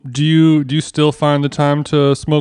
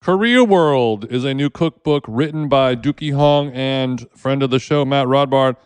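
ad read; 3 semitones lower, 4 dB quieter than usual. Acted. Read sponsored book ad.

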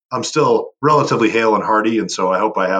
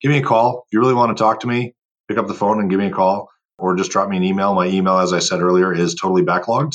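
ordinary delivery

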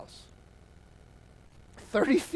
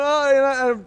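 crying; 6 semitones higher, 7 dB louder than usual. Retold or imitated. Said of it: "crying so hard that you make no noise".

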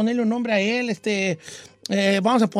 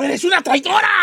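reproche gritado, tono muy agudo; la tribu la acusa a gritos; acusación actuada; 10 semitones higher than usual. Acted. (indignación teatral)

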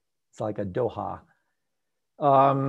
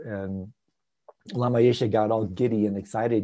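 normal talking voice

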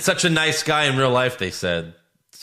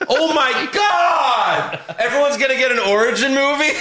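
excited shout; 12 semitones higher, 4 dB louder than usual. Real, not acted. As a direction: shout it in amused disbelief. Reacting to endless Nintendo movies.

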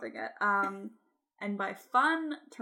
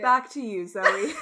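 news anchor sign-off; 8 dB louder than usual. Pretend. Mock reporter sign-off.

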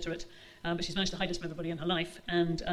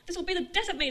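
much higher, louder wail; cried out; 11 semitones higher, 3 dB louder than usual. Acted. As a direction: call it out from the bedroom. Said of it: "their mother cried from the bedroom".

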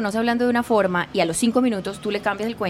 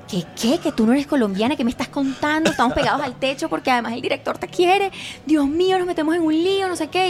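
rising, higher-pitched fretting; retold panic; 6 semitones higher than usual. Producer phoning her about the passport problem, retold panic. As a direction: start incredulous and climb into mild mock panic.

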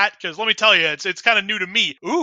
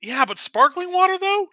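incredulous disdain; 10 semitones higher than usual. Acted. Disgusted at sparkling water.

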